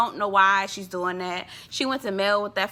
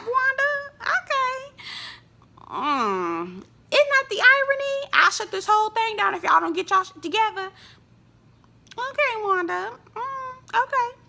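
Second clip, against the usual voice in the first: high, knowing sarcastic okay; sarcastic nod to Wanda; 12 semitones higher than usual. Real, not acted.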